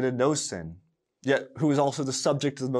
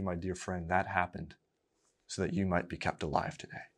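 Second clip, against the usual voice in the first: quietly, to the congregation, softer; gentle reassurance; 3 semitones lower, 6 dB quieter than usual. Real, not acted. Preacher to his listeners, softly reassuring. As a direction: tell your dear friend kindly.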